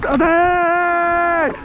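high, long-held cricket appeal; appeal shout. Real, not acted. Fielder appealing to the umpire, drawn-out high shout. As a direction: hold the cry high, pleading for the wicket.

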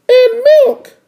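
very high cartoon child voice; little sister. Little sister spying words to match her brother, very high voice. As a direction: pitch it way up as a kid sister.

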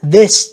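"this" with a buzzing voiced TH. Shown as a demonstration. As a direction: make the TH in "this" vibrate. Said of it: "mình thấy rung lên các bạn". ("this" với âm TH rung)

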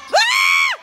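very high, loud fan shriek; sudden piercing scream at the play. Real, not acted. Screams at the game. (chillido agudo)

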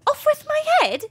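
very high, loud squeaky voice; shrill cartoon squeal. Alice in Wonderland voices.

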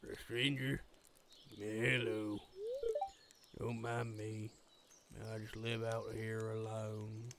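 low, soft, slow creepy hermit. Acted. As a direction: murmur it like a creepy loner. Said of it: "I kinda gave you a creepy voice".

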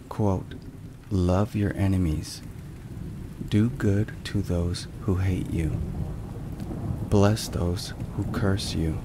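ordinary talk, nothing marked

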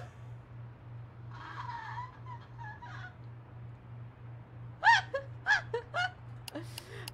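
long squealing giggle fit; high-pitched cackling laugh. Real, not acted. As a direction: laugh helplessly, high and breathless.

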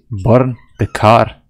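loud, drawn-out command to burn it; shouted order. Acted. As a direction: bark it like a gleeful arsonist. Gleefully ordering the game to burn.

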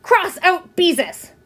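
put-on high, slow, emphatic child's shout; angry shout. A narrator's reading. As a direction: shout each word at your sister. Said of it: "and shouted".

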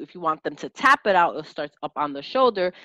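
ordinary talk

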